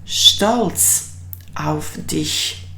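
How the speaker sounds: stolz auf dich said very slowly; slow, careful teacher model; slow model phrase. Shown as a demonstration. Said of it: "in German it translates as".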